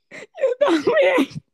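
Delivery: loud, high, stretched-out mock bawling; llorando exagerado. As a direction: act out wailing through tears, slowly and loudly. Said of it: "Pero llorando". (imitando un llanto chillón, fuerte y alargado)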